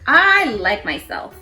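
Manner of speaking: plain reading voice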